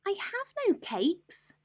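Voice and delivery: high, slow little rabbit voice; timid rabbit. Acted. Rabbit answering the robber rat, timid squeak. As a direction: squeak it out small and scared. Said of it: "the rabbit replied".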